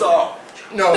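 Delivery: loud, high laughing no. Real, not acted. Laughing at the joke.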